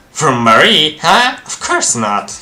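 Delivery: high, loud, incredulous questions; indignant disbelief. Acted. Reacts to accusation about blood.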